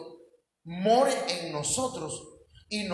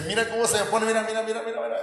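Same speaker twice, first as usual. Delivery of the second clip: agudo y atropellado, repitiendo con asombro; pastor emocionado imitado; 4 semitones higher, much faster than usual. Retold or imitated. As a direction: imita a alguien que presume escalofríos. Imitating pastor showing goosebumps. (emoción fingida, voz aguda)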